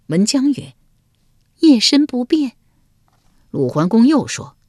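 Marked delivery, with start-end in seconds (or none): 1.6-2.5 high, coy court lady voice; lady Wenjiang. A narrator's reading. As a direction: answer sweetly as the young wife. (文姜娇声作答)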